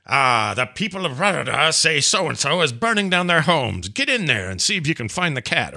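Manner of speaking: hammed-up quest prompt; quest announcer. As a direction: put on a quest-giver's urgent summons. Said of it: "it'll go".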